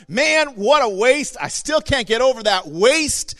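whining Judas. Retold or imitated. Judas grumbling about the wasted oil, mock whining. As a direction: play Judas griping, hammed-up. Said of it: "He's like".

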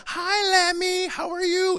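put-on high sweet baby talk to a pet lamb; kids greeting the lamb. Child greets the new pet lamb in high baby talk. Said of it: "It's like".

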